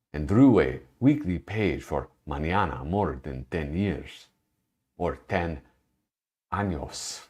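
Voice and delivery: a hint of a Spanish accent on the names and Spanish words. Streamer reads a Spanish cartoonist's bio aloud, mild Spanish accent. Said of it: "That's my Spanish accent".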